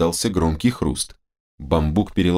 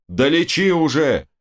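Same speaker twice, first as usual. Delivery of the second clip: high, drawn-out exasperated order; impatient demand; 8 semitones higher than usual. Acted. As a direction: urge the doc on, slow and high. Impatient friend demands treatment. (нетерпеливое требование, высоким голосом)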